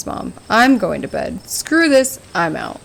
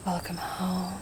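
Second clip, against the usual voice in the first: creepy stranger; 2 semitones lower, 16 dB quieter, slower than usual. Acted. Shadowy figure murmuring to Olive across the road, eerie. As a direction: breathe it out like a stalker in the dark.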